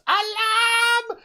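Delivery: high, loud, drawn-out mock yell; imitated alarm cry. Mimicking film's woman raising alarm, loud high cry. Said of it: "that woman who goes".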